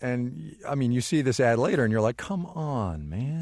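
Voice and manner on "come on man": softer, higher-pitched weary protest; mock exasperation. Viewer seeing who got the snake charmer job, exasperated. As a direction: complain under your breath in disbelief.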